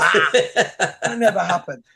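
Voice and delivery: slow, chuckling brush-off; laughing dismissal. Retold or imitated. To Dwight about his old letter, a laughing brush-off. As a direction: wave it away with a laugh. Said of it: "And it's like".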